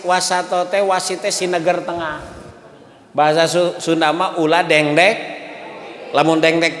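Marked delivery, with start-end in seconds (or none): none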